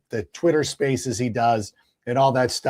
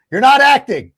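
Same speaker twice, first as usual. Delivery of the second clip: high and loud on not; emphatic jab; 10 semitones higher, 8 dB louder than usual. Real, not acted. Teasing Affleck on air, loud and emphatic. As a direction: punch the word not, half laughing.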